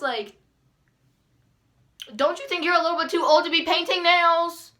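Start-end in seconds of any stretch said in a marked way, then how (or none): 2.1-4.7 high, loud, mocking retort; acted-out sassy outburst; indignant comeback. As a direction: fire the question back, raised voice, outraged. Imagined angry comeback to nail lady.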